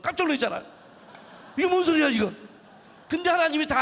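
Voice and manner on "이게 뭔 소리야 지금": startled. Retold or imitated. Startled during sleepy prayer.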